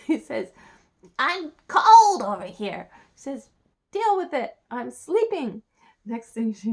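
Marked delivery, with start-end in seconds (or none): 1.2-2.9 slow, loud, high frog whine; hammed-up needy frog; complaining frog. 3.9-5.6 high, loud dismissal; acted irritated princess; annoyed princess. Princess snaps at frog.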